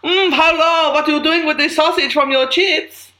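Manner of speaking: high, loud camp German; nose in as a saucy camp German; camp German accent. Acted. Returns to the camp German character.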